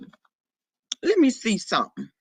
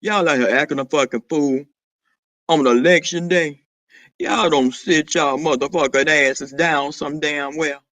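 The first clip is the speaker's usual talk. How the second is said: much lower, booming-down deep register; 9 semitones lower than usual. Acted. Scolding the people acting a fool up there, deep voice. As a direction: drop way down into your deep voice. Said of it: "Y'all know my voice said".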